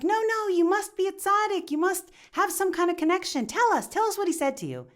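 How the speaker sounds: high, eager insisting; insistent pleading. Acted. Townsfolk press the stranger to reveal his secret, high and eager. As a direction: refuse to take no for an answer.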